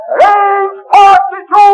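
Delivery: slow, drawn-out barked command; shouted order. Acted. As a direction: call the range to the gunners. Gunnery orders on deck.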